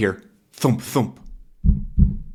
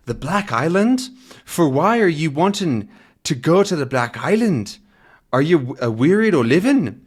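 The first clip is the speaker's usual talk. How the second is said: Scottish boatman; 5 semitones higher than usual. Acted. Boatman balks at request.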